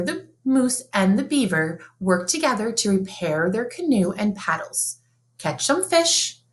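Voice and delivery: ordinary delivery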